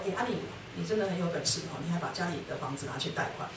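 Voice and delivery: quick, quiet needling praise; soft sarcasm. Retold or imitated. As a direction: tease him softly while accusing.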